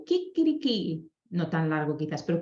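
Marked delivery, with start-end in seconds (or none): 0.0-1.1 lightly voiced kikiriki, higher, like a rooster; a gentle rooster imitation for children; a hint of a rooster crowing. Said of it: "hace el sonido de, de la, del gallo".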